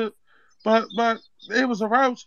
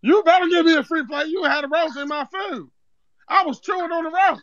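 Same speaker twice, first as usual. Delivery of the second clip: mock outrage in accent; 6 semitones higher than usual. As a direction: push it as the wronged customer. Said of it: "why is he talking like Zeke off power".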